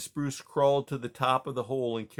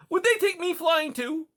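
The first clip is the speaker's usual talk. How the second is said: very high, loud, eager plea; hopeful young mouse; 5 dB louder than usual. Acted. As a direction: beg to go flying.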